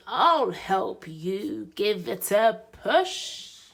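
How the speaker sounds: ordinary delivery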